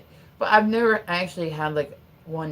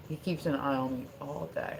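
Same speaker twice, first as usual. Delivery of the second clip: ordinary talk, nothing marked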